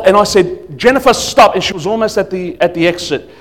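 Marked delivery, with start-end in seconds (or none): 0.8-1.5 raised, louder call to halt, retold; forceful command. Pastor calling after a member at the exit, forceful. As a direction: call out to stop someone leaving. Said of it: "all of a sudden so forceful".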